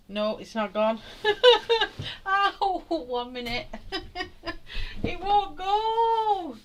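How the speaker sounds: laughing through cramp pain, high; pained giggles, pitch shooting up; getting a leg cramp. Real, not acted. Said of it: "horrible feeling in my leg".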